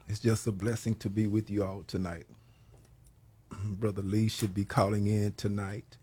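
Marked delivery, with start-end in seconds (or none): none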